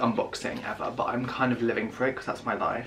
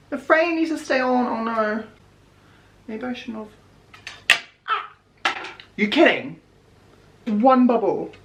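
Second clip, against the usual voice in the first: anxious; 10 semitones higher than usual. Real, not acted. Tense protector application.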